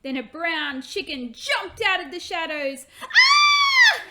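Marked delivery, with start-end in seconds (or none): 3.0-4.0 very high, loud startled shriek. Acted. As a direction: scream at the chicken jumping out. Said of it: "Hannah shrieked".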